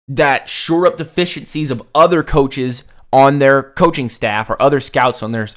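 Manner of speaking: normal talking voice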